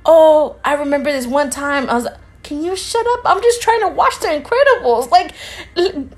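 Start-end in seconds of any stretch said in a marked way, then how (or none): 2.4-6.1 high-pitched exasperated plea, laugh creeping in at the end; acted exasperation. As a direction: plead with mock exasperation, higher and higher. Acted plea to a chatty date.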